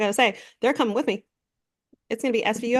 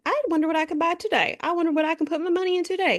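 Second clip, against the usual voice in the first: higher, put-on voice of a greedy schemer; mock greedy voice; 7 semitones higher than usual. Podcaster mocking the president's greed in a higher put-on voice. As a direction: lampoon him as a gleeful money-grabber.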